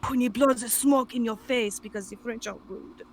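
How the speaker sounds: hammed-up French accent, badly faked; horrible French accent. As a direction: do a terrible, exaggerated French accent. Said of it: "horrible French accent".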